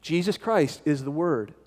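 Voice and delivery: ordinary delivery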